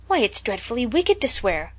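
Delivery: lightly shocked reproach; rebuking. A narrator's reading. Diana looks shocked at Anne, mildly rebuking. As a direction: scold a friend primly, mildly acted. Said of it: "she said rebukingly".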